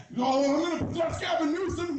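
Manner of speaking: high-pitched shouting devil. Acted. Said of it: "the devil's like".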